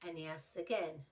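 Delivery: usual speaking voice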